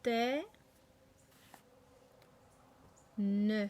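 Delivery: "Te" and "Ne" each said alone, held; isolated syllables. Shown as a demonstration. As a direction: give "Te", long pause, then "Ne". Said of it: "donc trois syllabes".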